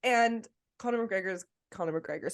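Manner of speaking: normal talking voice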